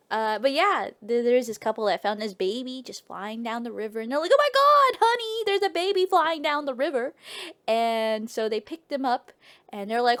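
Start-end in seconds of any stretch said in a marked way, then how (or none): 4.3-7.1 mock alarm. Wife calling husband to a baby in the river, mock alarm. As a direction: exclaim it as an acted shock.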